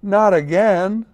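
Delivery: loud high cry of dismay. A narrator's reading. Unicorn watching his colors run, loud cry of dismay. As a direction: cry out in alarm. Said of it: "cried the unicorn".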